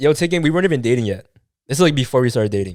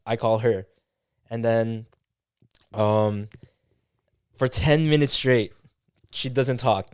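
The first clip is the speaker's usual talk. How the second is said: normal talking voice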